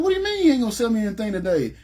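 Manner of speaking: high, fast, incredulous protest at the clerk; indignant retort. Retold or imitated. Customer confronting the clerk who refuses to sell, indignant. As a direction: demand an answer, outraged and rushed.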